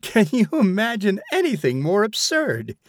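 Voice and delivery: high, scornful outcry; crying out. A narrator's reading. Mother scoffs to her friend about her son, crying out. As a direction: throw it up in disbelief. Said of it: "she cried to a friend".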